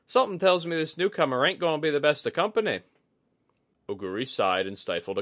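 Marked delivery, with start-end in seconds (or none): 0.1-2.8 high, loud, fast drawling gripe; Kudaka, wary. A narrator's reading. Kudaka to Oguri about the newcomer, wary. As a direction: mutter the dark guess quickly.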